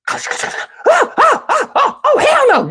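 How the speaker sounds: high-pitched mock shrieks; screaming girls. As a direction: imitate women squealing in a fight. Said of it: "You hear the girls".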